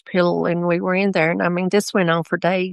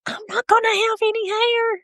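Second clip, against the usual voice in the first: put-on much higher, a child's matter-of-fact voice; little daughter's voice. Mother quoting her daughter in bed, a high childlike voice. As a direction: say it like a surprised seven-year-old. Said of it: "she said".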